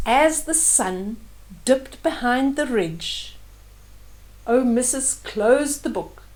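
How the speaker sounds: gentle, unhurried storybook reading; story narration. A narrator's reading. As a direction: read it like a bedtime story. Reads the story aloud.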